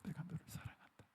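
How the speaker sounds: very soft whisper, almost no voice. Retold or imitated. Jesus whispering love to Peter, barely audible whisper. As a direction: breathe the words like a secret. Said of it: "그러는 거예요".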